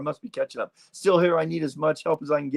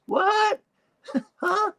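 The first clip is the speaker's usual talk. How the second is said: very high, squeaky mock "huh"; hard-of-hearing man. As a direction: imitate a deaf hotel man straining to hear. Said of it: "He can't hear".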